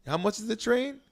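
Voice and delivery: high, playfully testing; teasing question. Retold or imitated. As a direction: lead him on with a sly question. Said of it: "Let him do some quick math".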